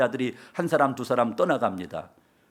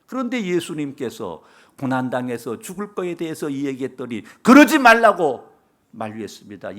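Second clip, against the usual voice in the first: ordinary talk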